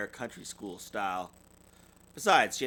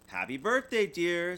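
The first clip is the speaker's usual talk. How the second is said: higher, cheerful parents' birthday greeting; Mama and Papa voices; 6 semitones higher, 4 dB louder than usual. Acted. Parents greeting their daughter on her birthday, cheerful. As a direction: sing out a happy birthday. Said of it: "said Mama and Papa Bear".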